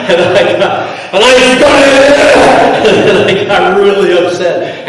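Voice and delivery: high, loud, drawn-out laughing telling. Real, not acted. Speaker recalls getting upset at the attacker, real laughter. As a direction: laugh through the memory, slowly.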